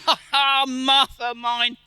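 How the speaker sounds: happy father's laugh; laughing greeting. Acted. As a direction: laugh with joy at seeing her.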